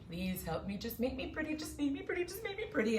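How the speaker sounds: begging, climbing in pitch; pleading petitioners. Retold or imitated. The avenger mimics desperate people begging for beauty, pleading. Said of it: "they ask and they say".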